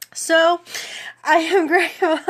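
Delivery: high, loud, choked with happy tears; tearful laugh. Real, not acted. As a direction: laugh while crying with gratitude. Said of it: "would be on here crying".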